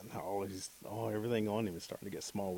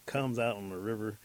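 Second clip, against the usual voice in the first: ordinary delivery, nothing marked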